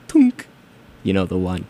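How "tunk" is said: high, loud plunky snare hit; snare imitation. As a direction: pop it out like a snare. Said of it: "really plunky snare right at the end".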